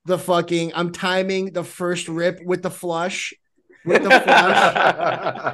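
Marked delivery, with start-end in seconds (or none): 3.8-5.5 laughing. Real, not acted. Laughing, slow.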